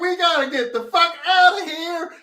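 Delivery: urgent, slow, high shouted warning; frantic warning shout. Retold or imitated. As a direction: yell it at them like their lives depend on it. Retelling the warning to players.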